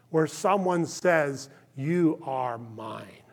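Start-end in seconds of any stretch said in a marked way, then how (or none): 1.7-3.2 low, slow and hushed, as God speaking; a low, gentle claim of love, drawn out slowly; slow hushed tender voice of God; tender whisper-soft. Acted. God's voice quoted tenderly.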